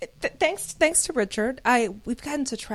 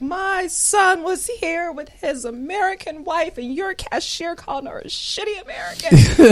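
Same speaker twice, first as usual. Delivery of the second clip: mother complaining; 8 semitones higher, 8 dB louder than usual. Retold or imitated. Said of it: "she's like".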